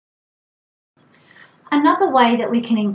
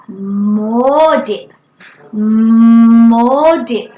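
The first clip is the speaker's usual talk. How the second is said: "more" with a long hummed m, loud; emphasis on MORE; 9 dB louder, much slower than usual. Shown as a demonstration. Parent adding a new word for a child, heavy stress on "more". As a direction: stretch "more" right out, then a short "dip". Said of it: "putting the emphasis on the new word".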